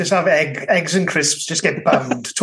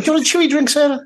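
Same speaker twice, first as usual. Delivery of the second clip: a higher-pitched, sing-song party host offering a chewy drink; mock party offer; 12 semitones higher, much faster than usual. Acting out offering a guest a chewy drink at a party, in a high mock host voice. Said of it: "If I said that to you, like".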